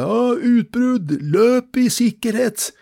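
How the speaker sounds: high, loud, drawn-out mock warning cry; imitated alarm shout. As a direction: imitate a guard yelling a warning across the camp. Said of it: "så roper han ene som jobbet der oppe". (etterlignet høyt varselrop)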